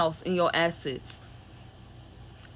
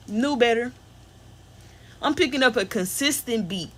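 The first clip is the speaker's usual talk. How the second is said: ordinary talk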